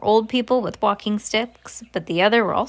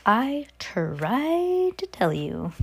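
very drawn-out mock reply; mock I-told-you-so; 3 semitones higher, much slower than usual. Grandmother answering the parent, drawn-out mock retort. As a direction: stretch it out slowly as a put-on retort.

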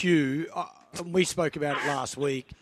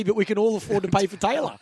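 ordinary talk

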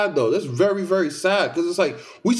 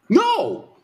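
emphatic no. Real, not acted. Flat refusal to co-host.

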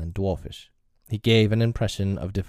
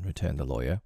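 ordinary delivery

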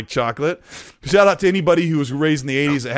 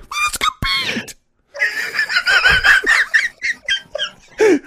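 very high falsetto plea; squeaky pleading voice; faster than usual. Retold or imitated. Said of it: "He's like".

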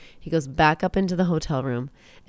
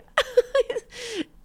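squealing laugh; high giggle. Real, not acted.

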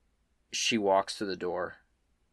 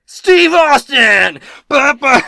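hammed-up Stone Cold entrance yell, much higher and louder; mock wrestling entrance; 19 dB louder, much slower than usual. GM hyping the Stone Cold Reavers with a wrestling yell. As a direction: belt it out like an arena hype call.

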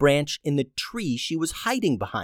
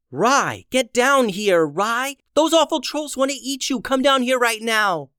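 yelling across the room; 10 semitones higher than usual. Acted. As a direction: shout urgently up into the branches. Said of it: "she called to the cat".

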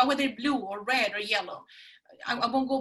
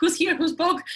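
mock startled, loud and higher; 7 semitones higher, 8 dB louder than usual. Retelling surprise at her own bold words to the host, mock startled. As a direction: act out shock at a voice from nowhere.

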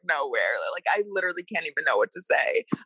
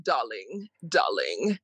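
imitating his darling; 6 semitones lower, slower than usual. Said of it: "you're saying".

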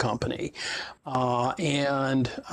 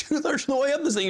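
much higher, amazed complaint; disbelief; 12 semitones higher than usual. Retold or imitated. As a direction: still stunned by the steep slope. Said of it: "I'm like".

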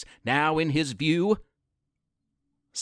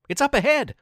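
higher, called out ahead to her friend; excited shout; 8 semitones higher, faster than usual. Acted. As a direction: shout it back over your shoulder. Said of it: "Teresa screamed".

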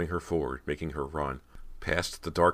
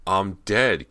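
heartbreaking; 5 semitones higher, 9 dB louder than usual. A narrator's reading. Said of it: "heartbreaking reply".

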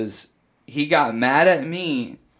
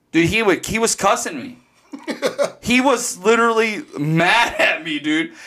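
usual speaking voice

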